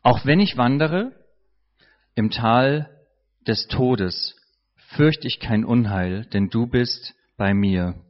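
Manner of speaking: low, slow psalm reading with long pauses; slow meditative reading. Shown as a demonstration. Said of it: "Das würde ungefähr so aussehen".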